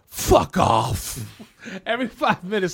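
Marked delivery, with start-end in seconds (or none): 0.1-1.2 low, forceful TV-drama swearing; hammed-up low, loud brush-off; mock dismissal. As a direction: spit it out like a TV boss. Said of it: "all they do is be like".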